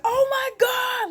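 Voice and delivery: high, loud, drawn-out mock wail; mock freak-out. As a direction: imitate a crowd freaking out, slow and shouted. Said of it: "everybody's freaking out and crying".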